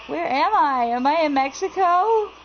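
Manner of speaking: high, loud, baffled outcry; loud bewilderment. Real, not acted. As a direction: call it out high in exaggerated confusion. Baffled by foreign-language sign.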